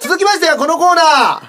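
high, rising show-host call; corner announcement. Real, not acted. Host to listeners introducing the next corner, announcer call. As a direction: announce the next segment with a big build.

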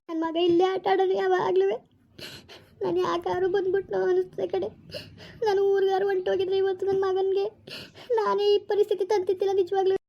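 high-pitched, crying self-reproach; tearful lament. Acted. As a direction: wail through tears as a distraught mother. Mother grieving for missing son. (ಅಳುತ್ತಾ ಗೋಳಾಡುವ ಧ್ವನಿ)

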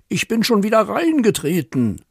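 high, indignant complaint; outraged neighbour bellow. Acted. Neighbour has stepped in dog mess, hammed-up outrage. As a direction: rail in theatrical exasperation. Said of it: "brüllt Nachbar Schneten".

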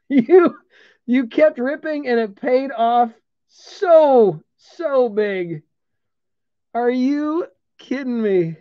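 high, drawn-out disbelief; overwhelmed and amazed, pitch still up; freaking out. Real, not acted. Breaker telling Kyle his ripping paid off, still freaking out. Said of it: "I'm freaking out here".

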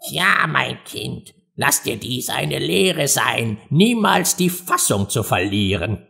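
put-on slow, subdued elderly parent voice; old crab. A narrator's reading. Old crab teaches a child a lesson, elderly and slow. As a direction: lecture slowly like an old parent. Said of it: "ein alter Krebs nahm die Gelegenheit wahr".

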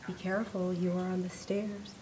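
a gentle woman speaking from above and behind; calm facility voice. Acted. The building's voice telling Mary where she is, calm and soft.

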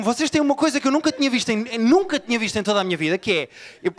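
normal talking voice

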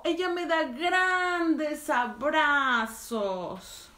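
drawn-out, beaming storybook line; big excited read. Acted. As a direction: make the hugs sound huge for the kids. Reading to kids, big finish.

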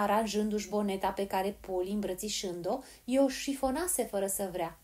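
ordinary delivery

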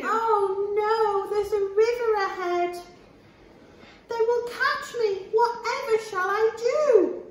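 high, worried cookie voice; gingerbread man voice. Acted. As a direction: fret about being caught. Cookie panicking again.

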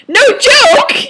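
very high, loud squeal; hammed-up emphatic yelp; shouted aside. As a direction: yell it like an overexcited teen.

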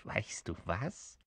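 soft, slow, sly creature; giggling. Acted. Little creature hatching an idea, quietly giggly. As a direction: say it softly with a hint of a giggle. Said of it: "kicherte das Kerlchen".

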